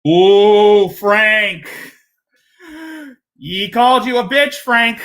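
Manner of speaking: loud teasing ooh, high and gleeful. Real, not acted. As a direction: stir the pot with glee. Host teasing over an insult.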